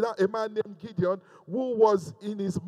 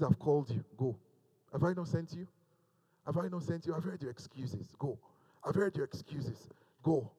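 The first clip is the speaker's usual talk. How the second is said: hushed, much softer, urgent; soft commanding voice of God; 6 semitones lower, 11 dB quieter than usual. Acted. Preacher voices God to one listener, hushed and urgent. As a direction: speak quietly into the camera as God. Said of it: "the voice of God echoing".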